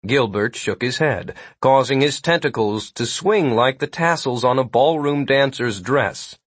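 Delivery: ordinary delivery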